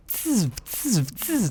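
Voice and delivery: high hissing tss of bullets flying past; bullet whizz. Retold or imitated. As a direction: mimic bullets zipping by your ear, pitched high and sharp. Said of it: "It's like".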